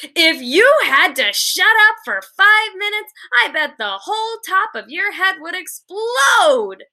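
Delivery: put-on snapping at a chatterbox girl, almost shouting; near shout. A narrator's reading. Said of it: "almost shouted".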